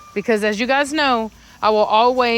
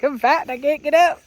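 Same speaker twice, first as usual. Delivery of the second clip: dog's voice; 6 semitones higher than usual. Retold or imitated. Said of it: "he's like".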